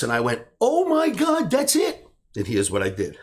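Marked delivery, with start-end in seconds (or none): 0.6-2.0 high, loud burst of discovery; light up as the idea hits; excited realization. Retold or imitated. Sudden realization of an opportunity.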